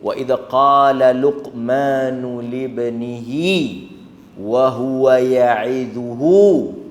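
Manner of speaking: slow, low chanted recitation; Quran recitation. Acted. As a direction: intone the verse slowly. (bacaan ayat perlahan)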